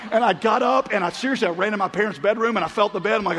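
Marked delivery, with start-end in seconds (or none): none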